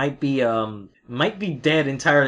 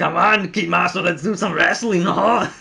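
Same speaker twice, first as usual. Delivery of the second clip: hammed-up dub voice; 4 semitones higher, 5 dB louder than usual. Imitating dubbed Kid Muscle line.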